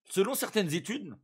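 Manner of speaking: talking through a mouthful; words round a bite of cookie; mouth full. Real, not acted. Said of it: "excusez-moi, je mange".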